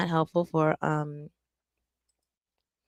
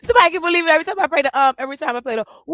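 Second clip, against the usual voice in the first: excited; 9 semitones higher, 7 dB louder, much faster than usual. Real, not acted. Excited gushing over the song.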